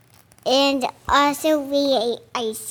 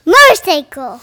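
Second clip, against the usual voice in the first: motorcycle blended into one quick loud word; a child saying motorcycle quickly to show his reading game; said fast; 2 semitones higher, 9 dB louder, faster than usual. Shown as a demonstration. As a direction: blurt motorcycle out fast and whole. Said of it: "Say it fast".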